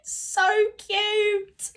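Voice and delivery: high giggling squeal; giddy squeal. Real, not acted. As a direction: squeal with delight. Squealing at a sweet line.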